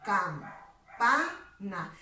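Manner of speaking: Campana spoken very slowly, syllable by syllable, with pa leaned on; teacher showing the stressed syllable in Campana. Shown as a demonstration. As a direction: spell out cam-pa-na slowly and land on pa. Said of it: "la mayor fuerza de voz va a recaer en la sílaba pa".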